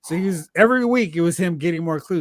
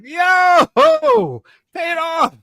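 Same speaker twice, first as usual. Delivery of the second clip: mock excitement; 13 semitones higher, 7 dB louder, much slower than usual. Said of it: "which I was like".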